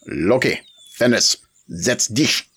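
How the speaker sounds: sharp German command to the dogs; commanding German master. A narrator's reading. Dog owner to his dogs, commanding German. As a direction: order the dogs to sit, firmly. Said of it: "spoke commandingly".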